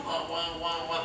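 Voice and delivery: very soft, wordless mumble; faint imitation of voiceless speech; imitating lost voice. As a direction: mimic a patient whose voice has gone. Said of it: "Sehingga dia omong tuh".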